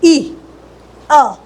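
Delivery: E sounded as ई and अ; letter sounds. Shown as a demonstration. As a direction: sound out ई then अ as separate vowels. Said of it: "E का".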